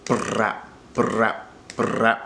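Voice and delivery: brap with the r trilled and held long; brap, the middle r held as long as possible; long rolled r. Shown as a demonstration. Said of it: "hold that R in the middle".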